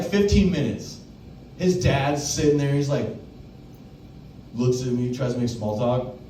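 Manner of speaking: ordinary talk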